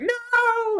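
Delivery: very high cartoon shriek. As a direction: squeal it out. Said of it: "And then the guy's like".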